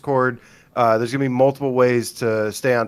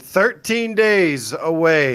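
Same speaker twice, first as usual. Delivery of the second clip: "thirteen days away" stretched slowly, starting higher; drawn-out announcement; 4 semitones higher, much slower than usual. Real, not acted. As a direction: draw out each word of "thirteen days away" to build anticipation. Hyping the countdown to pre-orders.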